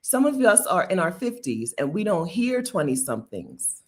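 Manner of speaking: ordinary delivery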